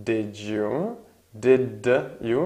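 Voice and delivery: usual speaking voice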